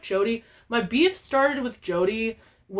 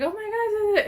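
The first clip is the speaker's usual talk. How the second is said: much higher squeal of delight; excited kid; 13 semitones higher than usual. Retold or imitated. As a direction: squeal it like a shy kid thrilled by a gift. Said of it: "she's like".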